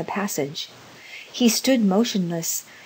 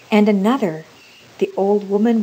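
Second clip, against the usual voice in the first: usual speaking voice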